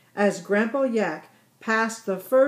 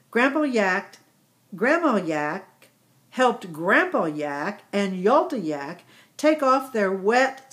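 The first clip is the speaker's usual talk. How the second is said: ordinary delivery, nothing marked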